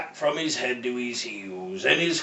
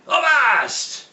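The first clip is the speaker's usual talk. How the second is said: higher, louder pirate shout of Avast; 10 semitones higher, 4 dB louder than usual. Acted. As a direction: bark the order across the deck.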